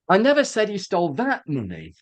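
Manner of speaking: "that" singled out before "money"; heavy emphasis on "that"; stress on THAT. Shown as a demonstration. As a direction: lean on "that", implying some other money. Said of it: "perhaps some other money".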